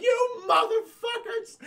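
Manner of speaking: put-on high, slow screeching old woman; outraged old lady. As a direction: shriek it like an outraged granny.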